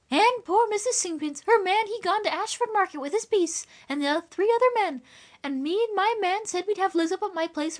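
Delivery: high-pitched rustic woman's jawing; gossiping country woman. A narrator's reading. Eliza jawing to a neighbour in the corner, high voice.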